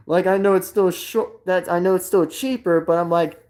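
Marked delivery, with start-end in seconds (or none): none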